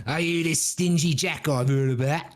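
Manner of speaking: hammed-up Cockney devil; English accent. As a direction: play the devil as a broad English rogue. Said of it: "our evil folk have English accents".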